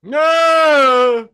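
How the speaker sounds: high, loud shocked cry; real disbelief bursting out. Real, not acted. Co-host shrieking in shock at the meme.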